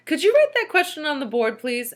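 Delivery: polite pupil's request; child asking. A narrator's reading. Rondi asking the teacher, innocent polite request. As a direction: ask it innocently. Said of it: "asked Rondi".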